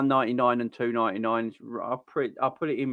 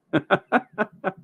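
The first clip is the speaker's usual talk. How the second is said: short laugh; 8 semitones higher than usual. Real, not acted. Laughs at German transfer rumour.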